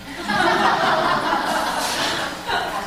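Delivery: high breathy burst of real laughter; laughing. Real, not acted. Preacher laughing with the congregation about her son.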